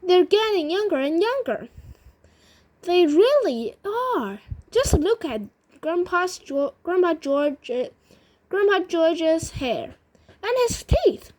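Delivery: high, eager storybook exclamations; acted amazement, well above narrating pitch; excited crying out. A narrator's reading. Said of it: "cried Charlie".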